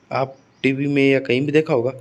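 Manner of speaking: usual speaking voice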